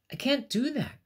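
high, loud anguished cry. Acted. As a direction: cry it out in dismay. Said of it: "cried the rainbow fish".

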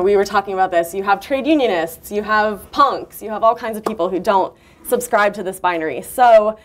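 ordinary delivery

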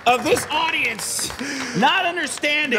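mock annoyed rant.

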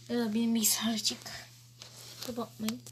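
hushed quick whisper. Real, not acted. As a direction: whisper it fast, aside. Aside while gaming.

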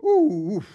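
loud mock demon grunts, far from his own voice; hammed-up growling groans. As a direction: grunt it out like the possessed man. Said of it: "He goes".

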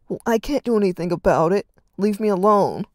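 loud, harsh dismissal; harshly. Acted. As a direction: shut him down hard. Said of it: "Reed said harshly".

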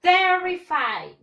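TERrified, first syllable high, loud and long; slow, emphatic "TER-ri-fide" for repetition; stress on first syllable. Shown as a demonstration. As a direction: punch the TER of "terrified", slowly. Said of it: "The stress syllable".

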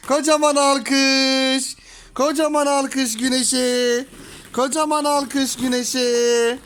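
very slow, stretched applause call; drawn-out cheer. Real, not acted. Parent calls applause for toddler, slow drawn-out cheer.

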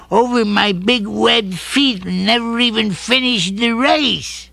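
a sad little duck fretting; timid little duck. Acted. Duckling telling the dog he'll trip, timid high voice.

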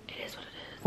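whisper. Real, not acted. Whispered resigned aside.

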